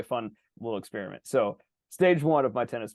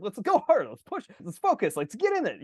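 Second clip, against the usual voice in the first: high, urgent mock pep yell; insistent coach-style goading; yelling at himself; 8 semitones higher than usual. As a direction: drive a lazy teammate on, voice pitched up. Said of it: "I'm, like, yelling at my elephant".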